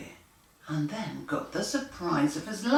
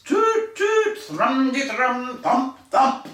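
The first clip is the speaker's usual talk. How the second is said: high loud toot, strum and thump; band noises; 7 semitones higher, 5 dB louder, slower than usual. A narrator's reading. Reading to children, trumpet, guitar and drum sounds. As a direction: play the loud band with your voice. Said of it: "It was a very loud band practice".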